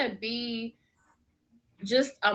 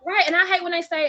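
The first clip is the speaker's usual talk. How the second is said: loud; 8 semitones higher, 6 dB louder than usual. Real, not acted. Loud burst.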